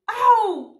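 high loud yelp; loud high-pitched "ow" cry; sharp cry. Real, not acted. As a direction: yelp out in outrage. Cries out mid-rant.

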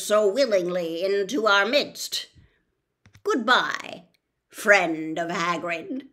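put-on loud, otherworldly old spider voice; ancient giant spider. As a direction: boom it out like a huge ancient spider. Aragog condemns the boys.

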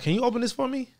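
sweet polite request; polite ask. Shown as a demonstration. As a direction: ask nicely. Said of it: "some sound rude, some sound polite".

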